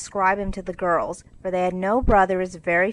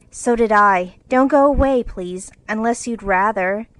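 put-on loud eager girl pleading; eager plea; 3 semitones higher, 7 dB louder than usual. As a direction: urge him warmly to stay. Jo begs him to stay.

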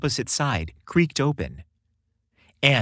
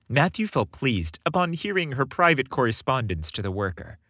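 plain reading voice

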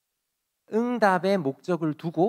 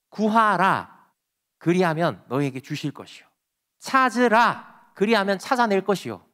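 solemn scripture proclamation; 3 semitones higher, 6 dB louder than usual. Acted. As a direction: declare it as God's word. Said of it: "이렇게 말씀하셨거든요". (힘 있게 낭독하는 성경 말씀)

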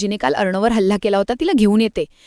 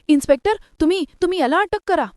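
angry demand; 9 semitones higher, faster than usual. A narrator's reading. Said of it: "अंजलीताई रागात बोलते".

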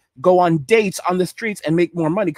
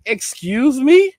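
a much louder, higher "Excuse me?", stretched slowly; shocked disbelief; 6 semitones higher, 4 dB louder, much slower than usual. Retold or imitated. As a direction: cry out "Excuse me?" in stunned outrage. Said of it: "And I'm like".

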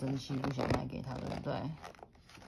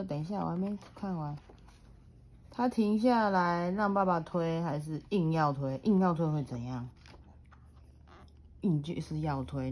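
put-on reading to a toddler; 2 semitones higher, 4 dB louder than usual. A narrator's reading. Mother reads picture book aloud.

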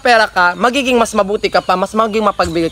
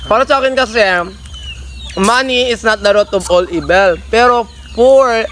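fruit at the lips; chewing between words; while eating fruit. Real, not acted. Seen 1: Left speaker holds java plum at mouth, plates of fruit before him.

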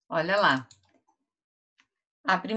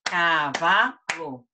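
"cavalo" slowly split as ca, va, lo; syllable by syllable; much slower than usual. Shown as a demonstration. Teacher claps out the word with pupils, syllable by syllable. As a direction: say "cavalo" one separate beat per clap. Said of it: "vamos bater palmas".

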